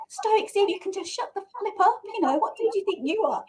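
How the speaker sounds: high-pitched mock scolding; retold telling-off. Scolding an imaginary shoulder companion in a supermarket, high mock telling-off. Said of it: "voice out loud".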